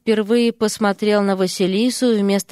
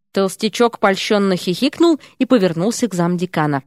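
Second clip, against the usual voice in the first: plain reading voice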